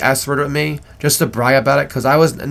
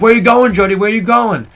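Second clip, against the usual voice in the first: higher, lightly raised call; retold calling out; 6 semitones higher, 3 dB louder than usual. Storyteller acts out shouting after fleeing friend, retold calling out. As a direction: imitate calling after someone leaving.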